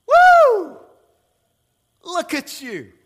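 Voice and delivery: Satan cheering Jesus on, loud and high; excited Satan. Acted. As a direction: hype Jesus up with a whoop. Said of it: "he goes".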